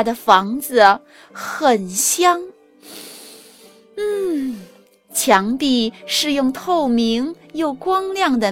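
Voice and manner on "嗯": usual speaking voice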